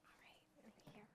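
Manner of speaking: hushed whisper away from the mic; quick off-mic whisper; whispered aside. Real, not acted. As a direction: whisper it to someone nearby. Offstage aside before speaking.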